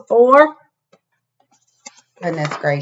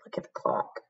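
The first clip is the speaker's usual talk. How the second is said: whisper; 15 dB quieter, faster than usual. Real, not acted. Pointing out the clock to a child, whispering.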